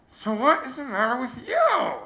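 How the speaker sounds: high-pitched mock-concerned question; smiling contestant. Contestant turns to the next crying girl, high smiling voice. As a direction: ask it high, through a smile. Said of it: "smiling, and she leans over".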